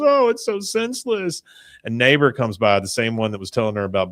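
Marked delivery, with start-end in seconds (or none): 0.0-1.4 high-pitched, hammed-up lament; mock crying. Peggy crying over the stolen gnome, mock tearful. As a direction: imitate a tearful woman, pitched way up. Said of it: "she's just crying".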